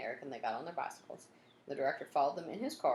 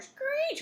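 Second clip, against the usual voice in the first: screech imitation; 7 dB louder than usual. Brakes screeching in chase.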